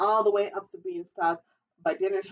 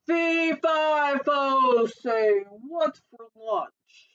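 booming giant; 4 semitones higher, 3 dB louder, much slower than usual. Acted. Giant announcing himself in the hallway for lunch, booming. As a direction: chant it big and slow, sinking at the end.

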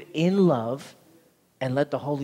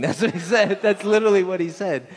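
louder, higher, laughing through it; laughing disbelief; 5 semitones higher, 6 dB louder than usual. Real, not acted. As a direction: laugh as you repeat it in disbelief. Laughing at the neighbour's retort.

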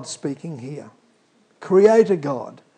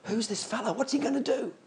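soft, higher sceptical murmur; hushed doubt; 4 semitones higher, 11 dB quieter, faster than usual. Retold or imitated. Broken mourners questioning the stranger, hushed. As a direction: mutter your doubt quietly. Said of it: "the broken crowd saying".

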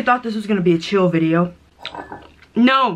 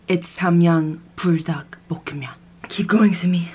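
whispered aside; 3 semitones lower than usual. Real, not acted. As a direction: whisper it as a secret joke to herself. Mock ad line then self-pep.

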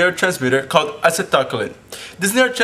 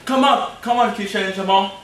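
calling out; 5 semitones higher than usual. Acted. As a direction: beckon them in cheerfully. Calling two friends in.

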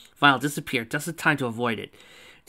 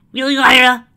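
loud, high-pitched disbelief; exasperated shout; 12 semitones higher, 14 dB louder than usual. A narrator's reading. Exasperated teammate reacts to giant robot.

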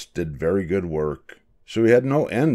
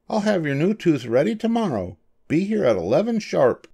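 high-pitched character voice; small, high doctor voice; little mouse dentist, businesslike; 10 semitones higher than usual. Acted. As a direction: instruct the patient, pitched well up.

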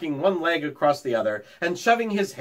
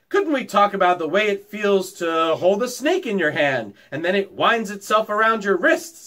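eager schoolboy asking; 6 semitones higher, 3 dB louder than usual. A narrator's reading. Boy asks about snakes.

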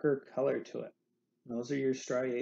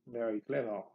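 mock French chuckle and remark; terrible French accent; 3 semitones lower than usual. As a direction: put on a hammy, bad French accent. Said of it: "that was a terrible accent".

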